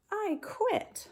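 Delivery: high put-on animal voice; elephant quitting. Teacher voicing a fed-up elephant for her class.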